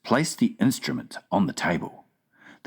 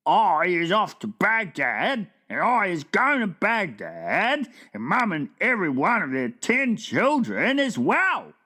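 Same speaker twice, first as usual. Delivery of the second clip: friendly giant; 11 semitones higher than usual. A narrator's reading. BFG quotes another giant.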